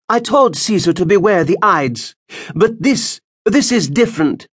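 put-on high, ominous old woman's voice; old seer Spurinna. Spurinna telling Moms of her warning, old seer's voice. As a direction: warn it like an ancient prophetess.

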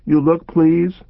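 put-on high, pleading child's voice; pleading little girl. A narrator's reading. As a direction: beg like a scared little girl. Child character voice.

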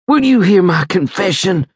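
high, frightened begging; frightened plea. A narrator's reading. Dying soldier asks medic to hear confession, frightened. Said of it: "he said in a frightened voice".

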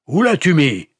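high, loud, angry shouted question. A narrator's reading. Pastor questioning the wounded Lapp, shouting. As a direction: shout the demand. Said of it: "cria-t-il".

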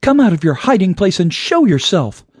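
high-pitched, angry demand into the dark; high heroine's mock roar; roaring challenge. A narrator's reading. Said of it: "Sparkle Girl roared into the blackness".

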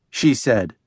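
ordinary delivery

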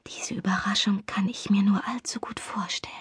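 breathy whisper close to his ear. A narrator's reading. Mimi whispering into Chris's ear before nibbling it, hushed whisper. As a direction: whisper it teasingly, barely voiced. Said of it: "flüsterte ich".

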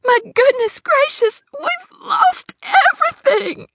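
very high, slow, breaking into tears; tearful despair. Acted. Hattie to the sheriff, losing everything, in tears. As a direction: cry as it all sinks in. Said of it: "Tears puddled in her eyes".

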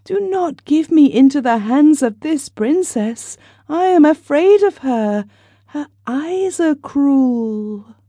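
put-on high child's voice, slowing at the end; little girl begging her father for protection; frightened little girl. A narrator's reading. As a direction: plead with papa like a scared small child. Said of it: "cried Blondine".

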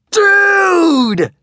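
high, booming yell of greeting; loud blasting shout. Acted. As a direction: blast it out like a huge excited kid. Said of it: "said a loud, blasting voice".

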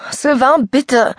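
put-on girl begging the boy not to make her swim; pleading. A narrator's reading. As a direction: plead with him, voice climbing. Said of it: "flehte sie".

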